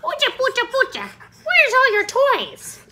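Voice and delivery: very high squeaky pet talk; high cooing doggy voice. Real, not acted. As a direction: squeal at the puppy playfully. Baby talk to her dog.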